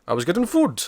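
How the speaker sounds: bad high Russian accent impression. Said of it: "I can't do a Russian accent".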